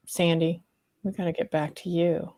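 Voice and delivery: normal talking voice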